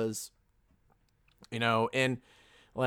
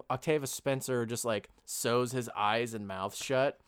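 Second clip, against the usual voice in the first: normal talking voice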